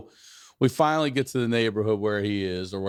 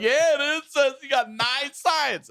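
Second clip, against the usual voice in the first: pushy buyer. Retold or imitated. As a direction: drag it out like a stubborn hustler. Buyers insisting on a sale.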